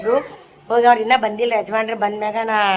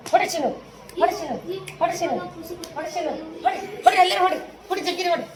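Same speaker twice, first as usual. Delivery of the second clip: excited cheering; 8 semitones higher, 4 dB quieter, faster than usual. Real, not acted. Cheering on children playing.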